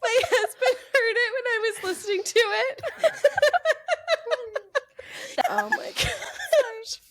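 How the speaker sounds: high, helpless laughing; laughing hard. Real, not acted. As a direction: laugh till the words squeak. Cracking up about husband hearing.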